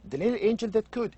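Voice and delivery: put-on high, fast childlike quote; child's voice. Teacher imitating a child reading the book title, high and fast. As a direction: sound like an eager child. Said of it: "So the child would say".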